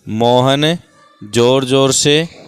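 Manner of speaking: loud, drawn-out prompt of Mohan जोर जोर से; slow loud prompt. Shown as a demonstration. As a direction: stretch the words to prompt the pupil. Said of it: "teacher prompts the sentence". (ज़ोर से खींचकर बोलना)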